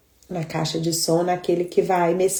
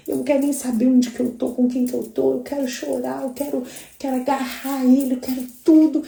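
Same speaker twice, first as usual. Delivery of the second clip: passionate; 8 semitones higher than usual. Real, not acted. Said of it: "eu fico bem louca".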